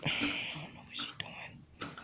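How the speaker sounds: soft unvoiced whisper. Real, not acted. Whispered aside beside baby.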